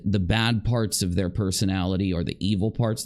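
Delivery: usual speaking voice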